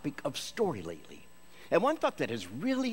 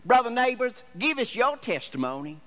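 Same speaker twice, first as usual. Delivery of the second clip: loud, high preacher call to brother; loud preacher summoning a congregant; 10 semitones higher, 6 dB louder than usual. Retold or imitated. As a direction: boom it out like a pastor calling on a member. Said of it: "give a testimony".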